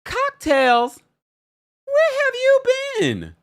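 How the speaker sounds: high, loud, drawn-out hello to a viewer; excited greeting. Real, not acted. Host spots an old fan in the chat, delighted squeal. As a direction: call out to a long-lost fan with delight.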